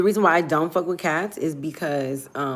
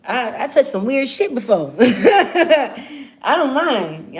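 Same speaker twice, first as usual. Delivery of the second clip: normal talking voice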